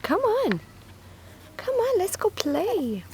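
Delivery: very high sing-song coaxing. Real, not acted. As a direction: lift it way up to coax. Coaxing puppy.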